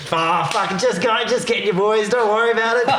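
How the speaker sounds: high, laughing cop waving it off; mock cop giving up the joke; imitating the cop. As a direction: pitch up and wave it all away. Cop relents, voiced in story.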